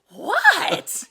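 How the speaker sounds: high, loud, annoyed "What?"; husband mid-breakaway yelling back at teammates, frustrated shout. Retold or imitated. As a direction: shout it back down the court, fed up at being stopped. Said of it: "And I remember you going".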